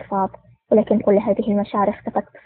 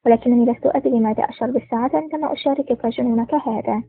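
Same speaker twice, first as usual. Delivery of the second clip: ordinary delivery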